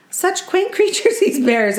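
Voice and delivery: high, swooning mock lady's gush; gushing exclamation. A lady tourist gushes over the bears, high and swooning. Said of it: "exclaimed one of the ladies".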